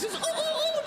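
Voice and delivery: very high squeaky ooh sounds; squeaky erasing ooh. Pretend. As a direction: squeak it out like a little eraser. Erasing name from list.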